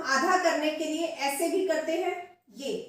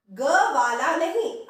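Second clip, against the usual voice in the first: usual speaking voice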